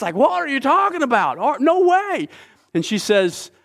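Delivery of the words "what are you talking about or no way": high, startled exclamation; shocked disbelief. Retold or imitated. The king reacts to Esther's accusation, high and startled. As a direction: pitch it up in stunned disbelief.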